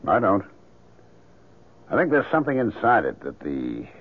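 normal talking voice

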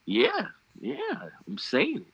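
high, breaking voice; voice cracking. Real, not acted. Co-host owns up to smelling collusion, voice cracking. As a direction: let the voice crack upward on the yeahs. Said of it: "my voice cracking".

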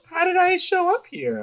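put-on high, confused little-girl squeak; dazed Jellybean. Imitating a twelve-year-old lost at a rave, high and baffled. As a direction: play the lost kid at a party, baffled and high. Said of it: "She's like".

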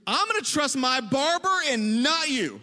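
high, drawn-out indignant retort; furious mom. Retold or imitated. Mom to teenager before storming out, mock furious retort. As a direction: imitate a mother's fiery last word. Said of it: "I saw flames leap out of her eyes".

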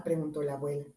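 plain reading voice

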